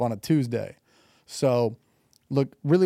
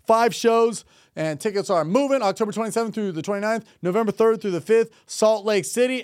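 higher-pitched announcer plug; tour promo read; 6 semitones higher than usual. Acted. Host plugging his co-host's shows in Houston, promo read. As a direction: announce the dates with showman's pep.

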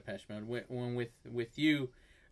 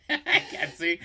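laughing through the words, pitched up high; real laugh breaking into the line; 10 semitones higher, 3 dB louder than usual. Real, not acted. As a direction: giggle it out while admitting it. Laughing self-deprecation.

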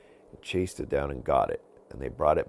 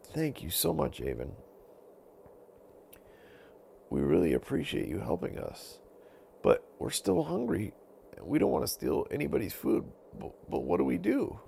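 lightly higher wolf voice, pleading; a hungry wolf asking for help; grateful hungry wolf; 11 semitones higher than usual. Acted. Wolves thanking Avon, a touch of a high pleading wolf voice.

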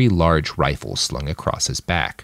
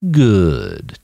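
drawn-out, self-satisfied purr; silky approval. A narrator's reading. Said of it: "Topaz purred".

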